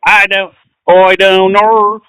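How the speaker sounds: slow, stretched mock Australian; exaggerated, drawn-out Australian attempt; Australian accent. Shown as a demonstration. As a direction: drag out "know" in a broad Australian accent. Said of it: "Is that how it goes?".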